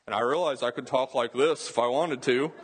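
briefly demoing an altered voice; change your voice for a line; a hint of a put-on voice; put-on different voice. Shown as a demonstration. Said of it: "I can change my voice".

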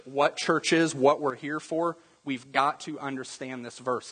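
normal talking voice

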